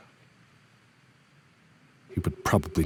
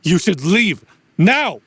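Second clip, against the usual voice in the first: louder and higher, rising to a yell on now; urgent shout; 10 semitones higher, 8 dB louder than usual. Acted. Mentor ordering his student to flee, urgent shout.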